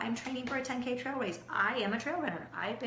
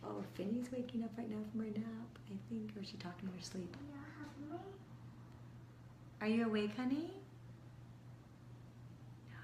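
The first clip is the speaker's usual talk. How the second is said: whisper; 10 dB quieter than usual. Real, not acted. Mother checking on her napping daughter, whispering. Whispering near sleeping child.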